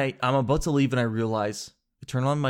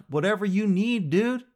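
tag-less eager agreement; 7 semitones higher than usual. Retold or imitated. Retold eager surrender to mechanic.

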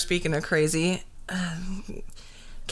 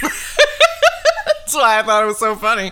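slow, loud words through tears of laughter; laughing so hard it's almost crying; 4 semitones higher, 6 dB louder, slower than usual. Real, not acted.

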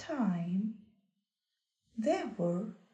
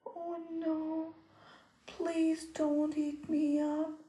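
pleading little goat; 6 semitones higher than usual. Acted. Goat begs troll.

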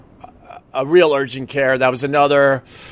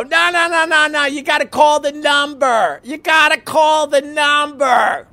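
mock receptionists; 11 semitones higher than usual. Husband mimicking desk ladies shouting him away, high mock shout. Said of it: "They go".

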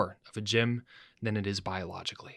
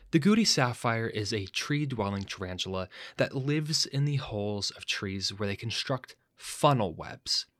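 ordinary delivery

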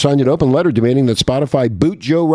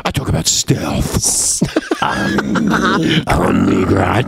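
slow alien voice, far lower; 6 semitones lower, slower than usual. Acted. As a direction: croak it like a space alien. Alien voice in villain rant.

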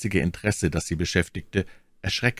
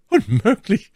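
high, louder cry of disbelief; exclaiming; 6 dB louder than usual. A narrator's reading. My Lady dismisses D'Artagnan's fear of death, exclaiming. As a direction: call it out high, as if incredulous. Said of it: "rief My Lady".

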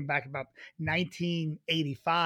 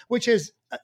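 ordinary delivery

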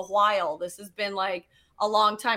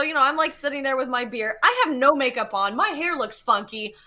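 ordinary talk

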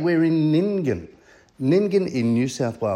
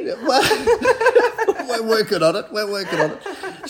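teasing through laughter, higher; laughing; 8 semitones higher, slower than usual. Real, not acted. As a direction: laugh while ribbing her kindly. Host teases the guest.

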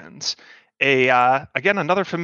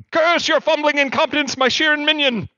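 higher, hammy ranting overlord voice; conspiracy streamer; 9 semitones higher than usual. Acted. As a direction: rant like a paranoid streamer villain. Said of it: "Talos struggles".

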